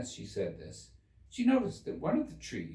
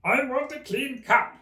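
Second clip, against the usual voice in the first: Hatter voice; 10 dB louder than usual. Acted. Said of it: "interrupted the Hatter".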